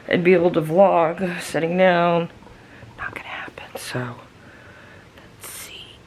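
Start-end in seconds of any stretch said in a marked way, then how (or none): 3.0-4.2 tired unvoiced whisper; weary whisper. Real, not acted. Migraine, whispering to camera. 5.2-6.0 tired unvoiced whisper; weary whisper. Real, not acted. Migraine, whispering to camera.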